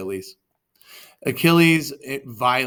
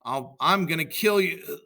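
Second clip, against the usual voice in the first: louder, higher angry shout; 4 semitones higher, 5 dB louder than usual. A narrator's reading. Dying Achilles shouting at Paris, loud and angry. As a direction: hurl it at the coward. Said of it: "Achilles began to shout".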